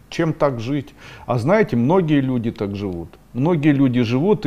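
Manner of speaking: ordinary talk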